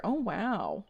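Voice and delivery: ordinary delivery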